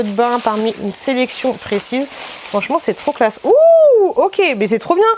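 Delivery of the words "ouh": high, loud, sustained whoop; delighted "ooh". Real, not acted. Vlogger admiring the classy packaging, delighted whoop. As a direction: let out a long high "ooh".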